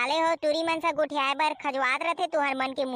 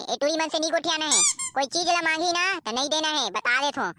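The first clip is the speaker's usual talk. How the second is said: normal talking voice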